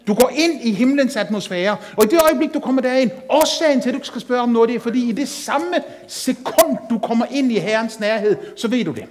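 fervent pulpit preaching; full-force preaching. Acted. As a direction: drive it home to the congregation. Preacher building to a peak.

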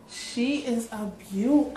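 ordinary delivery

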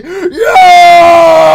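loud, high pirate shout; loud pirate-voiced Yar; pirate Foxy yell. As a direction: bellow like an angry pirate fox. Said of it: "Why are you screaming".

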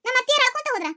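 usual speaking voice

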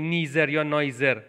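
"نیزر یا نایزر" with a buzzing z for th; z instead of th in "نیزر" and "نایزر"; th mispronounced as z. Shown as a demonstration. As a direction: say both forms of neither with z, NEE-zer, NYE-zer. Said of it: "توجه کنید که نمیگیم".

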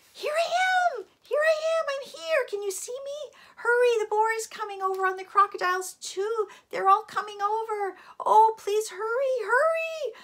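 very high, loud dragon calling for help; frantic yelling. A narrator's reading. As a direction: yell desperately to be noticed and rescued.

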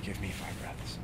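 voiceless whisper, reminding yourself to breathe; whispered to self. Pretend. Irritated person, whispering to themself to calm down. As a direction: hush it under your breath.

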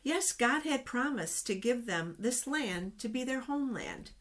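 plain reading voice

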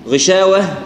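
غشاوة with a light alif after the shin. Shown as a demonstration. Teacher shows غشاوة with a light shin alif. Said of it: "شين هنا إيش؟ مرققة".

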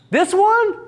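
very high "This one?"; the employee still doesn't get it; clueless question. Acted. Employee asking at the turn.

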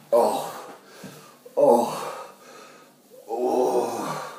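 ordinary talk, nothing marked

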